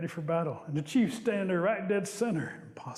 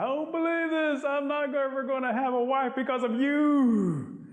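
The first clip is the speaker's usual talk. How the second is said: high, drawn-out hammed-up wail; mock crying; 8 semitones higher, 4 dB louder than usual. A narrator's reading. Possum blames Rabbit for having no wife, wailing. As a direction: wail it out like a sulking, play-acted crybaby. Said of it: "Possum's starting to cry".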